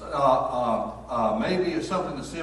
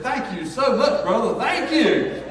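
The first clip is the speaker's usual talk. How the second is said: high, loud, laughing thanks; overjoyed grateful woman; delighted thanks; 10 semitones higher, 10 dB louder, slower than usual. Retold or imitated. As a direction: gush with joy. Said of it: "she said".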